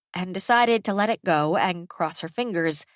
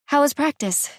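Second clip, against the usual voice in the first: put-on girl's voice; 8 semitones higher than usual. Daughter Farah speaks to mother.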